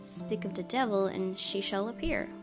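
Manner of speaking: ordinary delivery, nothing marked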